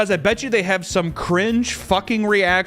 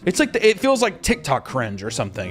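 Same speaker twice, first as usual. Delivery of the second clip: ordinary talk, nothing marked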